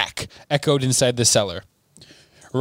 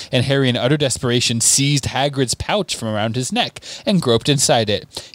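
usual speaking voice

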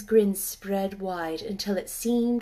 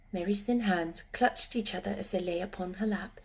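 ordinary delivery

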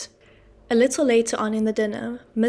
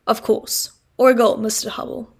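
a touch of irritation, louder; irritable assent; 5 dB louder than usual. Acted. Wopsle grudgingly conceding to Mr Hubble, mildly irritated. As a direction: concede it with mild annoyance. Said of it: "rather irritably".

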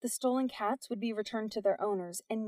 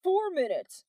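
yell; 9 semitones higher, 4 dB louder, slower than usual. A narrator's reading. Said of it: "he yelled".

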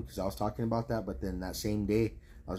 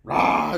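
1 mock angry roar; 7 semitones higher, 11 dB louder than usual. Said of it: "The fucking dude's like".

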